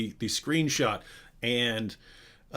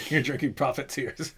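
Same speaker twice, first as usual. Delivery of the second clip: laughing. Real, not acted. Laughing at own mug joke.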